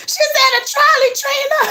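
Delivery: high-pitched, tickled laughing speech; laugh, then squeal the words out through it; cracking up mid-sentence. Real, not acted. Cracking up at trolley comment.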